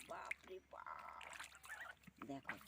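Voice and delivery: slow hushed unvoiced exclamation; awed whisper. Real, not acted. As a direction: breathe it out in awe. Awed at duck's anger. (বিস্ময়ে ফিসফিস)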